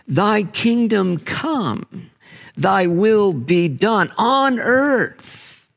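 slow, lifted prayer voice; slow chanted Lord's Prayer line; prayerful recitation. Real, not acted. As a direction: pray it slowly and reverently. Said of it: "Listen to the Lord's Prayer".

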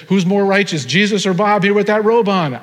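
joking quizmaster, punched up; game show host. Acted. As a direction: play the host putting it to contestants. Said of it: "game show in heaven".

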